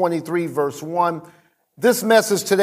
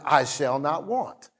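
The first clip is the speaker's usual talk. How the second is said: softer, murmured to himself; soft self-recital; 5 semitones lower, 6 dB quieter than usual. Retold or imitated. Pastor repeating the verse to himself at home. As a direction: say it quietly like a private reminder. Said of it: "saying it to myself".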